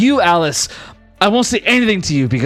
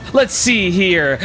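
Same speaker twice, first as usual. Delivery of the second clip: normal talking voice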